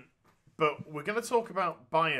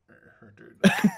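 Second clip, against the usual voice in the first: talking with the lip hidden; lip tucked in; 6 semitones higher, 12 dB louder, faster than usual. Shown as a demonstration. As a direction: tuck the lip under the teeth. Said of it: "I'm gonna talk like this".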